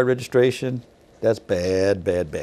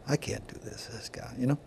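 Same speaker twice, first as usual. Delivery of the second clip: under his breath; 16 dB quieter than usual. Retold or imitated. Muttering thought under breath.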